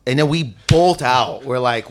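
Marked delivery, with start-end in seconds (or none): none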